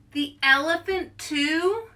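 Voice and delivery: teaching the refrain, high incredulous rise; mock dismay. Shown as a demonstration. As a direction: draw out the question in mock shock. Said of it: "So we say".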